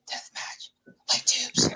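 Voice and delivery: eerie hushed whisper, barely voiced; spooky whisper. Whispering the magic words that summon Benno back. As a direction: breathe it like a secret incantation.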